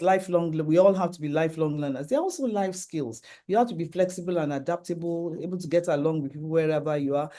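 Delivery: ordinary delivery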